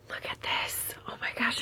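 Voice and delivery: awed whisper; hushed excited whisper. Real, not acted. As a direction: breathe it out in wonder. Admiring the shawl.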